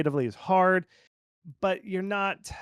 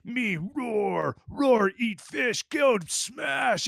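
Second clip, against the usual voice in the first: high, slow caveman bear grunts; a silly bear talking in broken words; 8 semitones higher, much slower than usual. Pretend. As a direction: play a dumb hungry bear bragging. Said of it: "in the voice of the bear".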